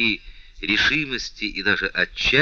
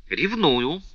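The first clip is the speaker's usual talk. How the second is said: proud and cold, owning it; haughty; 7 semitones higher than usual. A narrator's reading. The princess answers the count with haughty disdain. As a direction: toss the word off with disdain. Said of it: "надменно сказала принцесса".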